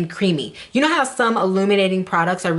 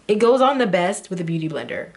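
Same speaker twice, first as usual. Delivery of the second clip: ordinary delivery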